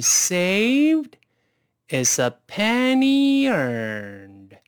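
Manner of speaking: very slow, drawn-out read with each word stretched; slowest speed. Shown as a demonstration. Teacher modelling the sentence for students at slowest speed. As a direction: spell out every word slowly for the learners. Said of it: "先用最慢的速度来一遍".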